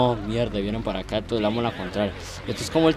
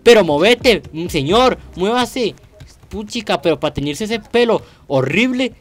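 panicked yelling; 5 dB louder than usual. Real, not acted. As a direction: yell at the players to get out of the way. Fleeing the tsunami in the game. (gritos de pánico pidiendo que se muevan)

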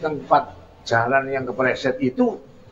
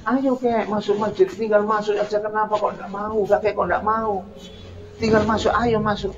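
higher, pleading and insistent; raised pitch dialogue; 7 semitones higher, 3 dB quieter than usual. Acted. The young man urges the old man to come inside, pleading in a high voice. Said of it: "Terjadi dialog agak tinggi nadanya".

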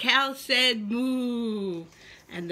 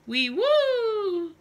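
high hooting owl voice; 8 semitones higher, 4 dB louder than usual. Acted. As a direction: hoot like an owl. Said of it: "said the owl".

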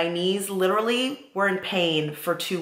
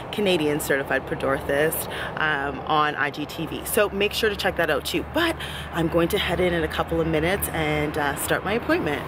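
ordinary talk

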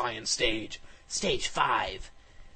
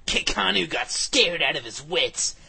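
ordinary delivery

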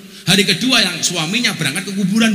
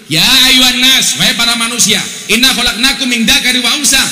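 Quranic recitation; 2 semitones higher, 5 dB louder than usual. Acted. Preacher reciting the Quran verse to the crowd. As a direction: recite it like a preacher quoting scripture.

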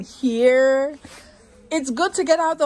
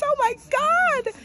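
excited. Real, not acted. Gasping at bags on sale.

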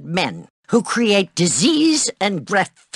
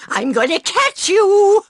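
chasing shout; 11 semitones higher, 4 dB louder than usual. Acted. Chasing a student.

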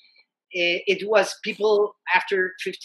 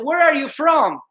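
loud, higher call across a crowd; 7 semitones higher, 4 dB louder than usual. Retold or imitated. French guy calling out to the newcomers, loud question. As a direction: call out to the strangers.

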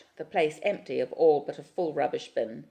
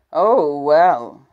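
growl; 17 dB louder than usual. A narrator's reading. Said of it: "his voice is a growl".

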